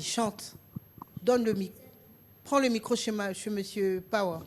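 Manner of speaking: normal talking voice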